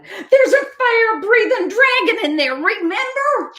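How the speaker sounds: high, laughing-edged alarm; frightened warning. A narrator's reading. Raccoon reminding Duck of the dragon, high and alarmed. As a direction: remind them of the danger, wide-eyed.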